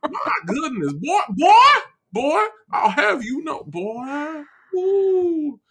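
blustery Southern cartoon rooster, high-swinging pitch; big drawn-out Southern cartoon voice; Foghorn Leghorn. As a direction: bluster like an indignant Southern gentleman. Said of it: "Foghorn Leghorn is his name".

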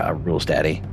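usual speaking voice

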